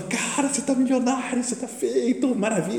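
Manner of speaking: very high, thrilled mock congratulations; overjoyed friend imitation. Friend hears the painting is authentic, high-pitched and overjoyed. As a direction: imitate a friend bursting with joy. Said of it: "Então fica uma alegria".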